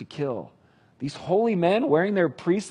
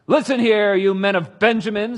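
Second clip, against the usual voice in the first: loud, raging summons to his men; angry king shouting; 6 semitones higher, 8 dB louder than usual. Acted. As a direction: bellow it at the officers like a furious king. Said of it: "Saul shouted to his officers".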